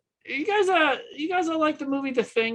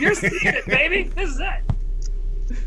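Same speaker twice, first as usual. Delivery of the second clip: laughing; 6 semitones higher, slower than usual. Real, not acted. Joking about his stand-up special.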